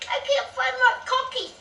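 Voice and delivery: put-on high wailing little girl; crying child. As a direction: wail it like a small child in tears. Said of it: "she's crying".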